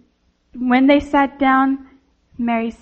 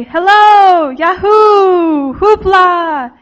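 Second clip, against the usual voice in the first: loud, higher-pitched drawn-out calls; boys shouting; 6 semitones higher, 9 dB louder, much slower than usual. A narrator's reading. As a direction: holler it out to raise an echo. Boys shouting to hear echo.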